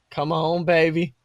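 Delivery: normal talking voice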